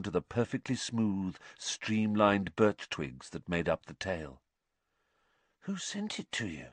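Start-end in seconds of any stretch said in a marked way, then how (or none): 5.6-6.7 soft, awed hush. A narrator's reading. Ron asking about the gift, in a hushed voice. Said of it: "said Ron in a hushed voice".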